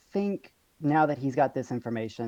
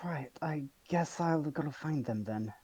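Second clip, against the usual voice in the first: put-on slow, soft Icelandic-accented drawl; 3 semitones higher, 6 dB quieter, slower than usual. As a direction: ease the line out slowly in an Icelandic accent. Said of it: "this accent's really killing me".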